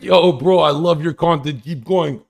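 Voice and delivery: loud, upbeat mock fan greeting; enthusiastic fan. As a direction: imitate a hyped fan calling out. Imitating an enthusiastic fan greeting him.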